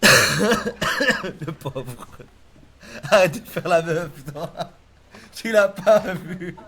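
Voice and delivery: loud laughing teasing; laughing hard at friend. Real, not acted. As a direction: tease him through loud laughter.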